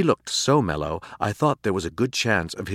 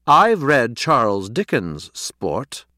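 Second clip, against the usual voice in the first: slow, higher, louder pronouncement; haughty retort; 4 semitones higher, 6 dB louder, much slower than usual. A narrator's reading. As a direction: declare it grandly, affronted. Cat's haughty evasive reply.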